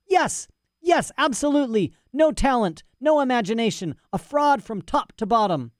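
put-on high, scornful old scholar's rant; outraged mad professor. Professor scoffs at rival's boasting, outraged. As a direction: spit out contempt in a high voice.

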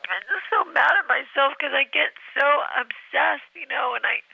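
crying as it spills out; tearful. Acted. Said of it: "I'm very emotional".

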